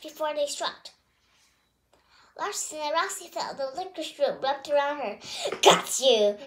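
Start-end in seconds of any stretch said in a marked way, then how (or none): 5.6-6.4 low, loud triumphant shout. A narrator's reading. Missus Wiggles catching Roxy, triumphant shout. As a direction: snap it out as she catches her. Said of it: "Missus Wiggles shouted".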